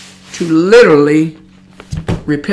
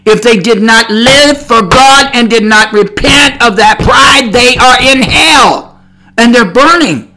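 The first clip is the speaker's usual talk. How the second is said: loud, raised-pitch hellfire preaching; fiery preaching; 6 semitones higher, 7 dB louder than usual. Real, not acted. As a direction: thunder the warning at the congregation. Preaches hell at full force.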